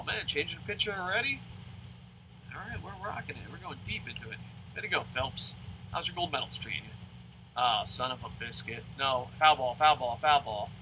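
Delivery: ordinary talk, nothing marked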